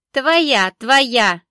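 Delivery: loud, pushy insistence; insistent. A narrator's reading. Vendor insisting the bottle is hers, loud and pushy. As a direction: insist over and over, loudly. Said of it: "настаивала торговка".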